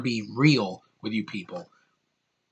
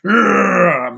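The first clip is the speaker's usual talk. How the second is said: admiring grunt; 5 semitones higher, 6 dB louder than usual. Retold or imitated. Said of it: "It's like".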